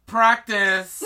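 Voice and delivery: high, loud mock holler; comic shouted advice; shouted coaching. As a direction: call it after someone far away. Chiron calling after dragged Grover.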